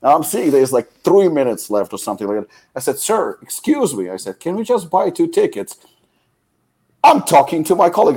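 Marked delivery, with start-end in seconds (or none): 3.0-5.8 higher-pitched, strained politeness; insistent retold plea; exasperated request. Acted plea to ignoring ticket seller. 7.0-8.2 imitating a clerk's raised-voice brush-off; high, emphatic mock annoyance; irritated rebuff. Acted clerk snapping back at customer.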